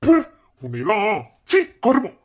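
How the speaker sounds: husband recoils from his wife's cruelty; disgusted outburst. Acted. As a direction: spit out the words in disgust.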